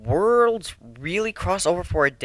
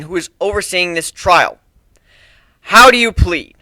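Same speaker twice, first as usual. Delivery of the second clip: firm, louder presiding voice; formal courtroom judge; stern judge; 6 semitones higher, 10 dB louder than usual. Acted. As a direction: open the trial with authority. Judge opens the trial.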